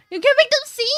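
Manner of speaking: very high, loud astonished squeal; real delighted shock; shocked amazement. Real, not acted. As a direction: gasp it out in disbelief. Gasped amazement.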